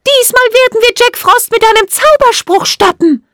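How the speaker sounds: very high, loud fairy voice; rally the troops in a tiny high voice; high-pitched, determined fairy shout; rallying call. A narrator's reading. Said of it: "rief sie".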